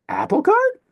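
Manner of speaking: "Apple cart" swung sharply up on cart; rising last syllable. Shown as a demonstration. Teacher demonstrates an acute accent, "Apple cart" rising high at the end. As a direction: lift "cart" high, like a question. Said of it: "It would go up".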